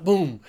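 loud, punchy "Boom"; loud storyteller's boom; impact sound effect. Retold or imitated. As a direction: drop it like a heavy thud.

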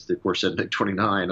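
ordinary talk, nothing marked

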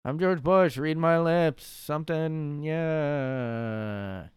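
hammed-up impression trailing into a long drawn-out final word; George Bush. Comic mimicking the stock presidential catchphrase, hammed-up impression. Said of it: "they do an impression of him".

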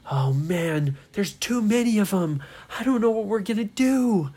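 rising to a high, loud worry; alarmed. Acted. Ryan to friends among too many merflins, alarmed. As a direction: build from dismay to mild mock panic.

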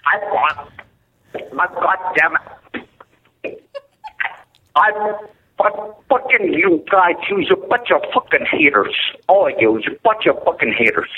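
loud, slowed, sputtering rage at the hosts; furious shout. Acted. Unknown caller screams at the radio hosts, furious. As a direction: yell it like a caller who has completely lost it.

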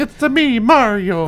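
high, hammed-up Italian American Mario; mock Mario accent. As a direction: toss off a cartoon Italian "me, Mario". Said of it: "stereotypical Italian American".

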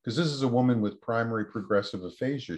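usual speaking voice

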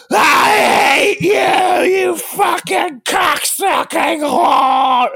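drawn-out, very high, furious mock scream; hammed-up raging shriek; imitated screaming. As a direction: scream it out, stretching every word. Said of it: "screaming in a way".

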